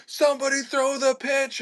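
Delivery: high-pitched mock yell; imitated urgent call. Retelling the captain calling for the pitch bait, urgent mock yell.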